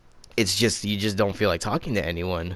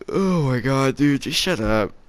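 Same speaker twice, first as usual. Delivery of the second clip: higher-pitched retold exasperation; exasperated; 4 semitones higher than usual. Student fed up with the crying teacher, exasperated. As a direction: groan it out, fed up.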